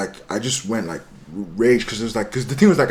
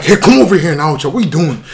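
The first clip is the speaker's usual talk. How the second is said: loud, raised summons with laughter in it; retold scolding; 5 semitones higher, 8 dB louder than usual. Summoning the friend who was at home, amused loud scolding. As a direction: order him over, half laughing.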